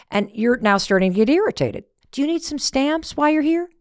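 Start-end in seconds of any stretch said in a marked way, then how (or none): 2.1-3.7 higher, cheerful clerk voice offering stamps; sweet clerk. Retold or imitated. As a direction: lift into the chirpy clerk. Postal clerk voiced in story.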